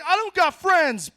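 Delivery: slow, high, loud mock despair; hammed-up lonely wail; self-pity. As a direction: wail it slowly to your own reflection. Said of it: "staring in the mirror like".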